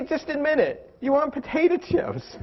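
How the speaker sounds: high-pitched, teasing call-out; playfully corner them into confessing; mock exasperation. Coach calling out a dieter's craving, mock exasperated.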